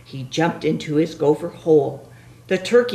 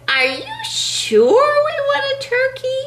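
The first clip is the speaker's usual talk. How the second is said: put-on high pleading child voice. Little pilgrim pleading.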